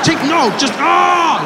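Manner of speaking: high frustrated cry; mock-exasperated agent, high and loud. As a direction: give up mid-sentence in frustration. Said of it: "he's just like".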